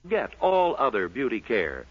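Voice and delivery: normal talking voice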